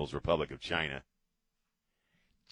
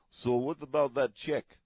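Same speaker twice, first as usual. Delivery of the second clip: mock Putin on the phone; 7 semitones higher, 4 dB louder than usual.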